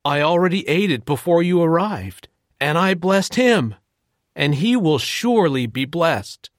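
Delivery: a touch of alarm, not full terror; trembling old Isaac. Acted. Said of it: "Isaac began to tremble violently".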